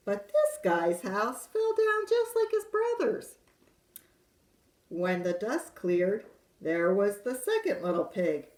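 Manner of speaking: lively wolf narrator telling his side; storyteller wolf. A narrator's reading. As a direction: read it as the sly wolf pleading innocence. Reads wolf's tale in character.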